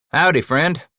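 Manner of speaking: exclaimed greeting. A narrator's reading. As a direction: call it out as a friendly hello. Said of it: "Bass exclaimed".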